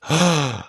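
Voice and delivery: delighted gasp. Retold or imitated. Wife walks into the model house, delighted gasp. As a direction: gasp like you just fell in love with a house. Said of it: "and she goes".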